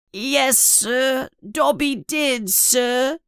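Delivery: high, meek little elf voice; guilty elf confessing; house elf voice. A narrator's reading. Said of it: "says Dobby".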